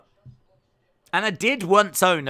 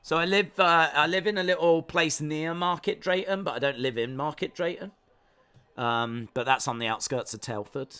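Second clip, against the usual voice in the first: normal talking voice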